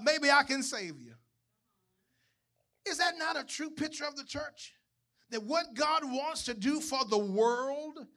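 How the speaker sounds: high, loud preacher build; preaching at full force. Acted. Preacher telling the congregation a story, at full force. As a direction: drive the story home to the church.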